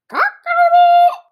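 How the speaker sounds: high, loud rooster crowing. A narrator's reading. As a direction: crow it out like a rooster.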